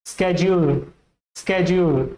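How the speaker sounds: schedule said sked-jule, American accent. Shown as a demonstration. Teacher modelling the American accent. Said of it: "American English".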